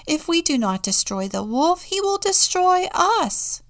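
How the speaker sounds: loud, rising to a high cry; alarmed warning. A narrator's reading. Contessa warning the friar, alarm building. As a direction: build to a high, urgent finish.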